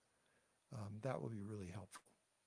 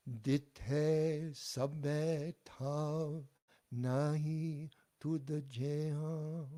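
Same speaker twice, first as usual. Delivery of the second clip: "Dithe sabbe thav, nahi tud jeha" very slowly and higher, loud; slow model line; 4 semitones higher, 6 dB louder, much slower than usual. Shown as a demonstration. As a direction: lead the whole line slowly for the class. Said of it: "all together the line".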